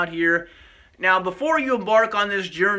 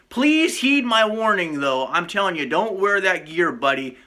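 normal talking voice

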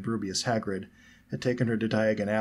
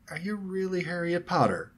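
high, abrupt doubting question; skeptical; 9 semitones higher than usual. A narrator's reading. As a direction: ask it bluntly, not quite believing. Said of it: "same air of skepticism in her voice".

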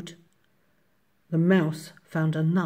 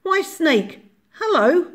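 high little mouse voice; cheery mouse; 12 semitones higher, 7 dB louder than usual. A narrator's reading. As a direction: chirp it as a confident mouse. Mouse greets snake in story.

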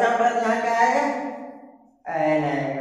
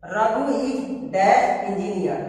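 slow exercise reading; 3 semitones lower, much slower than usual. Shown as a demonstration. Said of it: "Fill in the blanks".

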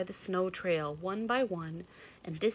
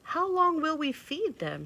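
little sister asking; 11 semitones higher, 6 dB louder than usual. A narrator's reading. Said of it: "she asked".

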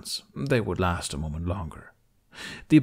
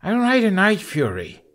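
high, loud, proud declaration; triumphant boast; 12 semitones higher, 6 dB louder than usual. Acted. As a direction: announce it like a trump card. Hiccup boasts while tossing her.

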